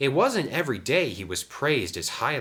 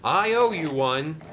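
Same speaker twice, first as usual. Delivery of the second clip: higher, louder cheerful engine voice; Sam the engine; 9 semitones higher, 5 dB louder than usual. A narrator's reading. Sam calls thanks to another engine, cheerful. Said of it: "said Sam".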